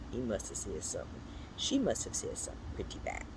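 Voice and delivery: fast, whispered scandalised aside; a quick conspiratorial whisper; hushed aside. Acted. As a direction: whisper it like juicy gossip. Gossiping aside about a rude cat.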